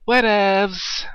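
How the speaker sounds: whatevs said high and sing-song; high mocking imitation of the annoying word. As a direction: mimic the annoying friend mockingly. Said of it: "like when he says".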